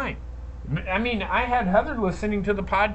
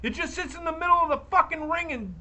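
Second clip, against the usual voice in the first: loud, higher furious rant; angry yelling; 5 semitones higher, 4 dB louder than usual. Real, not acted. Telling the McMahons to book the women right, shouting. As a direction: tear into them like they're listening. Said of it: "I'm yelling at fucking the McMahons".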